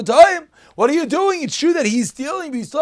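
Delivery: high, loud, incredulous rebuke; mock outrage. The rabbis scolding Rav Huna, loud incredulous rebuke. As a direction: scold him in disbelief.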